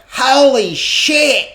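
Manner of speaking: very high, loud, drawn-out holler; imitated scream. Dad hearing of the engagement, imitated astonished scream. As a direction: bellow it slowly as an astonished dad. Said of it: "And just screamed".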